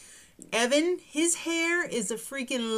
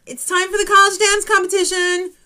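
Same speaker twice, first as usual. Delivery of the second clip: announcer; 8 semitones higher, 10 dB louder than usual. Acted. Host announcing next segment.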